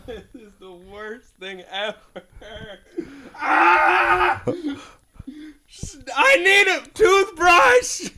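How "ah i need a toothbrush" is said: disgusted shout. Real, not acted. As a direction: yell in real disgust. Disgusting jelly bean in mouth.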